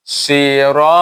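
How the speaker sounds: সিরা with the vowel stretched long and slow; long drawn sira. Shown as a demonstration. Teacher demonstrating to students, a long drawn সিরা. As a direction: draw out সিরা slowly, full-mouthed. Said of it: "এ খালি লম্বা করবে".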